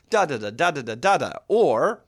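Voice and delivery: "da-da" opening strong and falling away in a triple beat; rolling beat, first syllable of "da-da" stressed; varied metrical rhythm. Shown as a demonstration. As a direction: chant "da-da" as DA-da-da, ending DA-da. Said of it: "What different rhythms can I create?".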